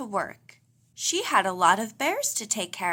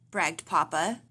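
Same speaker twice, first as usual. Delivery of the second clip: usual speaking voice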